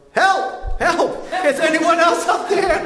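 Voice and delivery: high, loud desperate shout; yelling for help. Acted. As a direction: scream it upward as the punchline. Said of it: "James yelled".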